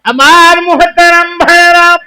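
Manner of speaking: impassioned high-pitched preaching; full-force preaching. Acted. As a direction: ring it out on a high sustained pitch.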